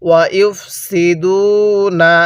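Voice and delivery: slow drawn-out tajweed recitation; Quranic recitation. Shown as a demonstration. Teacher leading students to repeat, slow recitation. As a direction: stretch each syllable for the class to repeat. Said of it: "হ্যাঁ, পড়ুন".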